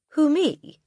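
high, surprised squeak; puzzled boy. Acted. Boy puzzled at the headmistress's accusation. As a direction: sound genuinely baffled.

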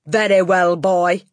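loud, domineering headmistress; Trunchbull. A narrator's reading. Headmistress turning on the unfortunate boy, loud and stern. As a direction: address him loudly and sternly.

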